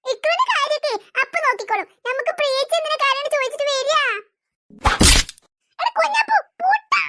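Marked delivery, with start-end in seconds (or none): 5.8-7.0 put-on high, tearful wail at the boy; crying wail.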